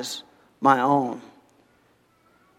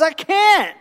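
pleading servant; 8 semitones higher than usual. Acted. Servant begs for mercy.

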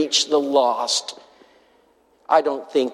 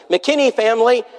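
raised-pitch waiting-room call; 9 semitones higher, 3 dB louder than usual. Retold or imitated. Doctor comes out to the waiting room, calling out. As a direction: call the family's name across the room.

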